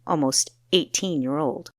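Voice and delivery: plain reading voice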